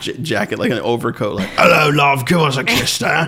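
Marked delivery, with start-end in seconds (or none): 1.6-3.3 loud, hammed-up Cockney lecher; Cockney old pervert. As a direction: leer it out in broad Cockney. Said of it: "every, uh, Cockney character I've ever done".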